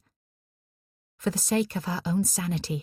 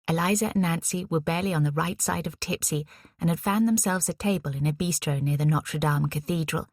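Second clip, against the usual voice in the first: plain reading voice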